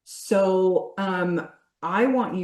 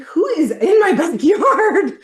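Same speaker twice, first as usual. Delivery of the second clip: high, loud, slow startled cry with a laugh; alarmed neighbor; 13 semitones higher, 10 dB louder, slower than usual. Retold or imitated. Coach mimicking a neighbor alarmed by the screaming. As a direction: shout out like a scared neighbor. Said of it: "It's like".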